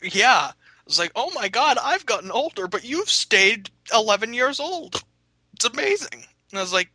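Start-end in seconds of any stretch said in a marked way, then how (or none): 1.2-6.2 mock old lady wonder. Churchgoers amazed he never aged, high mock voice. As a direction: marvel like a dotty old lady.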